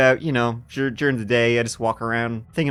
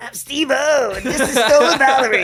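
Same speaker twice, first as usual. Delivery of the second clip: high excited TV-host intro; hammed-up stunt show opener; Steve-O impression; 13 semitones higher, 6 dB louder than usual. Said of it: "So you're like".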